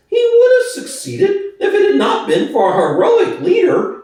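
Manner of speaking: high, oily, wheedling pig voice; Squealer's high voice. Acted. Squealer to the animals, high and wheedling. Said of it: "Exclaimed Squealer".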